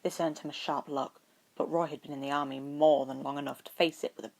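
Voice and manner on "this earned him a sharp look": ordinary delivery, nothing marked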